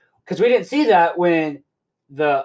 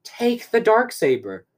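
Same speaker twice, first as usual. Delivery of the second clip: higher-pitched, insistent mock hero; Mandalorian; 6 semitones higher than usual. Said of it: "Moff Mandalorian goes".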